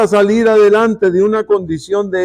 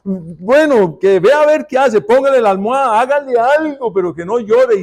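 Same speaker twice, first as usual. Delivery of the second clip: frantic parent; 6 semitones higher than usual. Acted. Parent voiced hushing crying baby.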